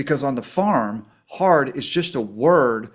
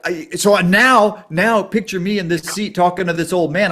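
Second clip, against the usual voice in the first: normal talking voice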